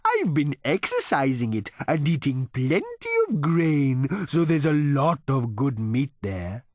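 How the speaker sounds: high, slow, proud cow voice; a cow boasting of its meat; cow character. A narrator's reading. Cow boasting of its meat to Zaphod, in a high, slow proud voice.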